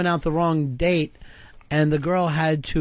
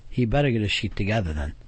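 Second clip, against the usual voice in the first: retold warning; 8 semitones lower than usual. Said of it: "she goes like this".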